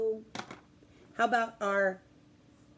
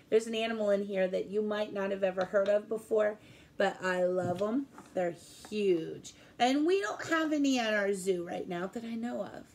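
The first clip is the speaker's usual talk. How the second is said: normal talking voice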